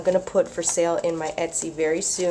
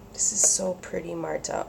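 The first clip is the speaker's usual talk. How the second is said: awed whisper; 2 semitones lower, 6 dB quieter than usual. Real, not acted. Admiring paper.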